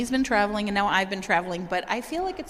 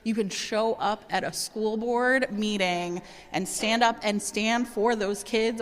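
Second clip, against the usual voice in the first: ordinary talk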